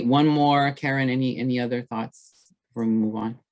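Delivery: ordinary talk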